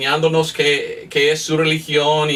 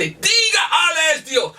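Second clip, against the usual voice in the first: much higher, shouting the order; violent demand; 10 semitones higher than usual. Retold or imitated. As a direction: bark the command at him. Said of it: "muy violento".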